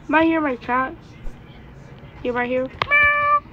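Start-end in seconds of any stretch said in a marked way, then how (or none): 2.9-3.4 high squeaky cat meow. Acted. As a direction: meow like a kitten. Voicing the cat.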